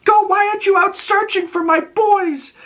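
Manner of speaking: very high, frail put-on old woman voice; a lost mother pleading, dazed; confused old mother.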